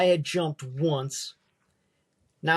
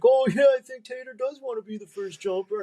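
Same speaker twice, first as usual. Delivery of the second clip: higher mocking voice volunteering him; mock the jumper, playfully sly; teasing jump master; 6 semitones higher than usual. Jump master volunteering Tater to jump first, high teasing voice. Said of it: "the jump master was like".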